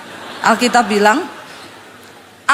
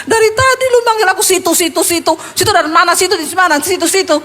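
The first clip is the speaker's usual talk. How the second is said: higher, exasperated comic retort; mock indignant; 6 semitones higher, faster than usual. Pastor complains inwardly at being called "situ", mock indignant. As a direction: mimic the nagging repetition with growing irritation.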